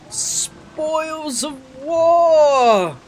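very slow, high, drawn-out announcement; grand drawl. Acted. Gamer shows off loot, slow grand proclamation. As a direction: savour each word like a trophy. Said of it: "emphatically say".

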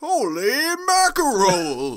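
hammed-up holy-mackerel shock; put-on amazement. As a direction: exclaim like a comic-book reporter. Comic exclamation in put-on voice.

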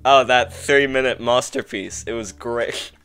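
normal talking voice

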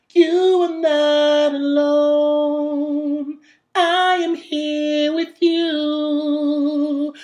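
thin, pinched singing tone. Shown as a demonstration. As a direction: sing with a high larynx. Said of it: "That's with my larynx raised a bit".

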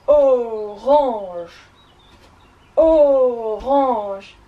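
loud, drawn-out elephant voice; big elephant. Shown as a demonstration. Teacher leads class as big elephants, booming voice. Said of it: "in your big elephant voices".

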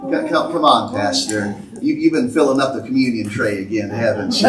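a hint of a listener scoffing at the pastor; mock skeptical churchgoer. Congregant teasing the pastor, low mock disbelief. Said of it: "You'd have been like".

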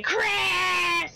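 high, drawn-out mock scream; retold scream. Player retelling Wesker in the lava, mock screaming. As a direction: imitate his scream, stretched out and high. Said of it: "while he was screaming".

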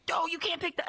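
high-pitched mock objection; imitating alarmed coworkers. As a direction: object like the fussing crew. Retold coworkers all object.

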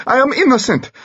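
shrieking, very high panicked denial; scream. A narrator's reading. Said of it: "Dimitri screamed".

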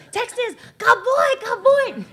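imitating the Mongolian horsemen hailing the Texan; laughing imitated horsemen. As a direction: mimic the horsemen's delight. Horsemen quoted.

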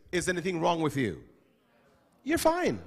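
normal talking voice

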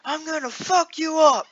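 high hammed-up threat; mock angry partner. Correcting her quote, high hammed-up mock anger. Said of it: "No, she's not gonna fuck me".

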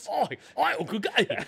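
mock Aussie Michael Caine; syllables slurred in a hammed-up Australian accent. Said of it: "Michael Caine as an Australian".

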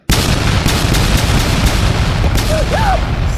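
high, loud drawn-out gasp; long shocked gasp. Acted. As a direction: gasp like a cartoon in horror. Long dramatic gasp in the skit.

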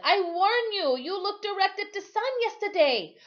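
put-on old sailor's high, firm warning. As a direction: raise the voice high to warn the boy. Timothy warns boy.

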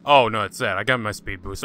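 usual speaking voice

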